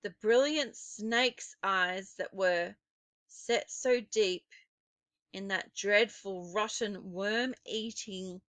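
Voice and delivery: plain reading voice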